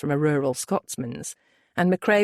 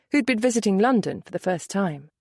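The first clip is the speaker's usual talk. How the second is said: plain reading voice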